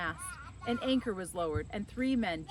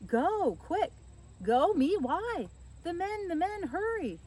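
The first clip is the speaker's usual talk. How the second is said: urgent excited urging; 10 semitones higher, 4 dB louder, slower than usual. Acted. Urgent argument about going.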